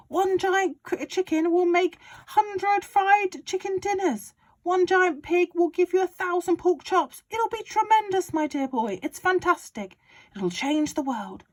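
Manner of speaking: high, loud, gleeful father; excited Mister Cranky. Acted. Father urging George on about the medicine, high and loud. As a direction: crow it like a man with a scheme.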